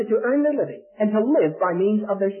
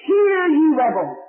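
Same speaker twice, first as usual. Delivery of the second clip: loud, slow, high rebuke; righteous anger; 8 semitones higher, 7 dB louder, slower than usual. Acted. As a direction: thunder it out slowly at rebels. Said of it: "in righteous indignation, he rebuked".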